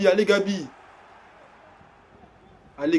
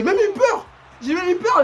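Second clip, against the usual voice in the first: laughing; 7 semitones higher than usual. Real, not acted. Laughing about his fear.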